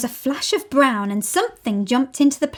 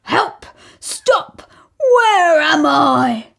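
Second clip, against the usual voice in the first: dazed cry; 6 semitones higher, 7 dB louder, much slower than usual. Acted. Crocodile tumbling, dazed.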